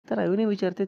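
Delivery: usual speaking voice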